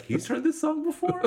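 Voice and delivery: high-pitched, disbelieving question; mock incredulity. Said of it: "I love his incredulity".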